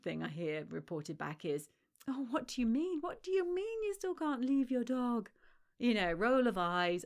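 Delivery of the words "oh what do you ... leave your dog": incredulous, higher mocking voice of a smug friend. Friend mocking a struggling dog owner, incredulous. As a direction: sneer at the owner who still can't leave her dog.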